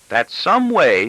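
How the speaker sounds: put-on teenage daughter reproving her father; petulant daughter. A narrator's reading. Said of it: "in a petulant tone".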